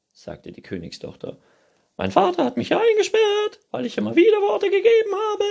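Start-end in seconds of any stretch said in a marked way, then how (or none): 2.0-5.4 very high, loud, fast screech; princess. A narrator's reading. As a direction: rattle off the grievance shrieking. Princess figure complaining. (hohes, schnelles Prinzessinnengezeter)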